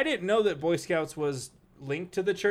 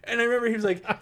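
ordinary talk, nothing marked

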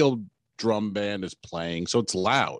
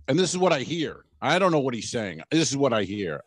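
ordinary talk

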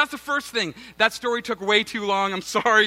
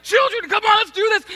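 put-on high, bright call to the kids; rallying the children, chirpy and upbeat; cheery mom-style rally; 10 semitones higher, 3 dB louder than usual. Pastor rallying his kids at home, chirpy high call. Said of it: "So I'm coming home like".

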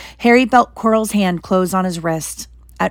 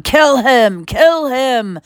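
a touch higher, repeated yell; mild yells; 6 semitones higher, much slower than usual. Acted. Voldemort yells at Quirrell to kill Harry. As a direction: bark the order twice. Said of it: "Voldemort's yells of".

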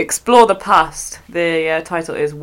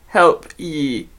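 slow, deliberate Scottish with couldna and ye; Scottish accent; 3 semitones lower than usual. Shown as a demonstration. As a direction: demonstrate the written Scots dialect slowly. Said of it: "it's spelled I couldna".

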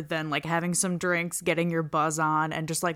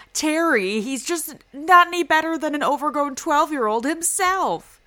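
mock exasperation; 8 semitones higher than usual. Wife scolds husband over babysitter.

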